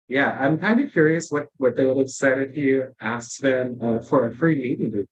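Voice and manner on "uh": ordinary talk, nothing marked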